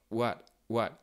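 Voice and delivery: what with the air blocked in the throat at the end; glottal stop. Shown as a demonstration. Teacher showing the blocked air on what. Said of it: "On bloque l'air".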